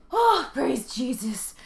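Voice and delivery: relieved whisper. Real, not acted. Relief that arc is over.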